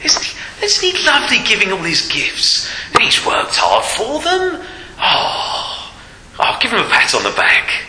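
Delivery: high, hushed, fawning gush; admiring onlookers. Acted. Preacher mocking the world fawning over a rich giver. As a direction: coo over him like an adoring crowd. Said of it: "the world looks on and says".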